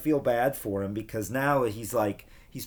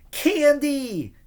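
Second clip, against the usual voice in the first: high, loud gleeful cry; excited yell; 10 dB louder than usual. A narrator's reading. Kids catching thrown candy, excited high yell. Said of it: "kids yelled".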